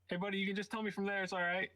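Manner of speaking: soothing the frozen goblin; coaxing. Acted. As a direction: reassure him he can stay put.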